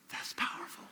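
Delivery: hushed, voiceless whisper of awe; awed whisper. Retold or imitated. Crying listener responding to a stumbling testimony, imitated awed whisper. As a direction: imitate someone moved, barely getting it out.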